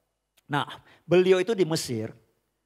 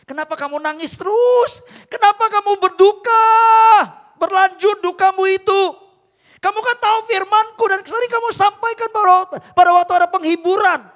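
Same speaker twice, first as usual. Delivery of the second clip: higher and louder, a booming divine call; voice of God; 13 dB louder than usual. Acted. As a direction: speak as God rebuking a grieving child. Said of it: "Tuhan tuh ngomong gini". (suara Tuhan yang lantang menegur anak-Nya)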